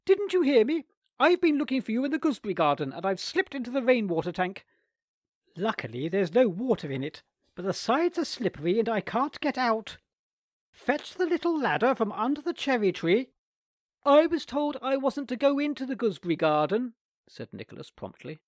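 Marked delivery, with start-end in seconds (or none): 0.0-13.3 high, loud, hurried aunt; distressed aunt. A narrator's reading. Aunt trapped in the tank calling Nicholas, high and urgent. 14.0-17.0 put-on high, loud boy reciting rules; prim boy. A narrator's reading. Nicholas refusing his aunt, higher prim boy voice.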